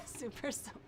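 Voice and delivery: whispered, sarcastic aside. Real, not acted. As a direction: breathe it out with disbelief. Doubts the sober claim.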